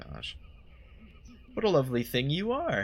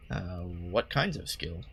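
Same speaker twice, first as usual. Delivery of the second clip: ordinary talk, nothing marked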